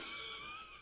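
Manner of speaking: short squeaky giggle; high giggle. Real, not acted. Laughing at her own Tinder reply, a quick high giggle.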